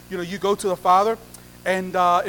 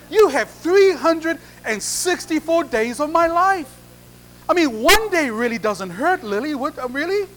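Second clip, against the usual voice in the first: louder, higher-pitched wheedling argument; pleading protest; 5 semitones higher, 4 dB louder than usual. Acted. Groom bargaining with Lily over one day, pleading. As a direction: argue your case as if she's being unreasonable. Groom argues with reluctant bride.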